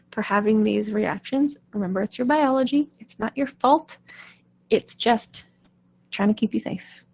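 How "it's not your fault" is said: normal talking voice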